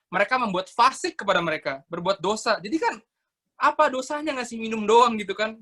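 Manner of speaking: usual speaking voice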